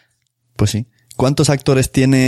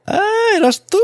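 surprised. Real, not acted. Host realizes guest played mother.

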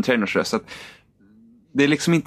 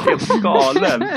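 mock angry; 11 semitones higher, much slower than usual. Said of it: "när man ska säga något arg".

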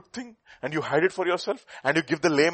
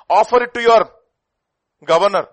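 loud, high challenge; preaching shout; 8 semitones higher, 13 dB louder than usual. Acted. Preacher challenging the priests, loud and high. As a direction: hurl the question at them.

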